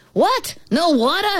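high-pitched incredulous outburst; outraged disbelief. Acted. Mean ant finding no water, in high outraged disbelief. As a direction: balk at it, voice shooting up.

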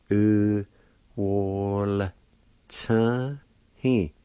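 U, wol, ch, He said apart; sound by sound. Shown as a demonstration. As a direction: pick out each sound carefully. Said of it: "like this".

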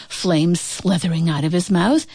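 ordinary delivery, nothing marked

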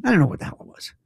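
normal talking voice

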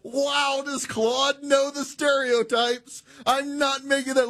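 high, drawn-out incredulous delight; amused amazement. Real, not acted. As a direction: marvel at it in a high voice, fresh from laughing. Amazed, amused by assistant's joke.

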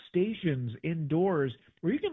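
normal talking voice

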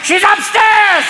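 high, slow mock French voice; mock French accent. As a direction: sing it out like a coy Frenchman.

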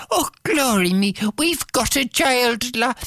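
high, joyful exclamation; overjoyed parents. Acted. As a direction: cry it out in delight. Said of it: "And they said".

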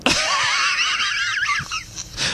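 falsetto burst of laughter; squeaky helpless laugh; high squealing laugh. Real, not acted. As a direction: crack up high and long. Laughs at caller's joke.

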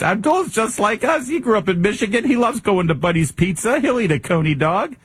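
high-pitched folksy endorsement parody; mocking high-voiced testimonial; mock campaign-ad voice. Mimics campaign ad grandparents.

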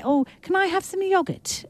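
high, lightly posh English voice; Aussie host teasing guest with a hammed-up English accent; mock English accent. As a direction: play a tongue-in-cheek Brit asking for yogurt. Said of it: "words that you notice that you start to say".

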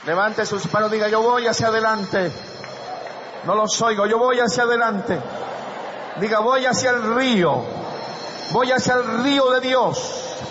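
high, loud declarations to be repeated; rallying the congregation. Real, not acted. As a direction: shout each phrase for the crowd to echo. Leads congregation in shouted declarations. (arenga a gritos para que repitan)

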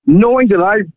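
ordinary talk, nothing marked

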